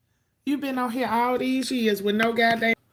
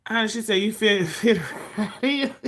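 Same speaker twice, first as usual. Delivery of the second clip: breaking into a laugh mid-phrase; amused, laughter cutting into the words; 2 semitones higher than usual. Real, not acted. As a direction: read it out and crack up partway through. Amused reading of a chat comment.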